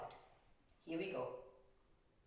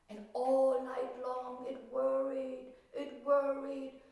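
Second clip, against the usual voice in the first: slow, louder, dwelling on worried; worried repetition; 8 semitones higher, 5 dB louder, slower than usual. A narrator's reading. Narrator stressing the violet's all-night worry. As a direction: stretch out the worry.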